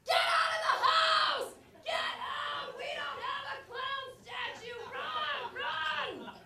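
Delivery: very high, drawn-out screaming; full-out panicked shouting down the phone; terrified screamed warning. Acted. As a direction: scream for her life, slow and piercingly high.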